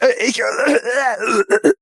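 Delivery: fighting for air, voice breaking; hyperventilating. Acted. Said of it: "Ich kriege keine Luft".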